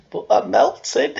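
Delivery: high, loud, slow melting wail; Wicked Witch of the West. Acted. Repeating the film line for viewers, loud witchy wail. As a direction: stretch it out, climbing to a loud high end. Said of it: "the Wicked Witch of the West".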